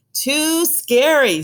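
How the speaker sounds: put-on high, slow, frightened little girl voice; scared. Rosie refusing to camp outside, scared and drawn out. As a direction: stretch it out like a scared kid.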